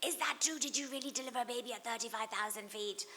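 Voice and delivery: high-pitched mock amazement; put-on wide-eyed disbelief, high; imitated amazed question. Retelling a listener's amazed question.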